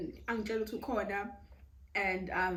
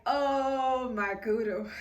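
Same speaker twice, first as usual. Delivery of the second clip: slow drawn-out oh my kudo into a laugh; giggling oh my kudo; laughing disbelief; 5 semitones higher, 4 dB louder, much slower than usual. Real, not acted. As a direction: stretch it out while laughing. Reacting to being summoned.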